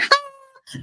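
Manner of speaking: high breathy mock squeal. Mocking her in the photo shoot, with a very high mock squeal. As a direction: squeak it like a posed jump.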